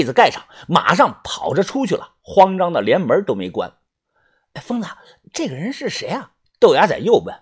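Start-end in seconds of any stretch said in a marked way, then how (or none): 4.5-6.3 curious and half-whispered; hushed question. A narrator's reading. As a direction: ask a friend discreetly, keeping it quiet. Friend quietly asks about sick girl.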